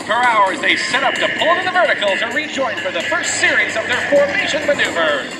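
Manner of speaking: upbeat live announcer; airshow commentator. Acted. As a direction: call the show to the crowd.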